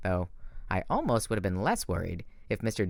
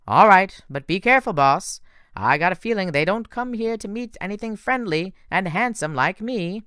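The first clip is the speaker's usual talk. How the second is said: higher, louder, vain horse; cocky Pegasus; 9 semitones higher, 5 dB louder than usual. Acted. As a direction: warn him with a swagger. Blackjack warns, vain.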